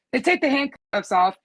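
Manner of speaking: usual speaking voice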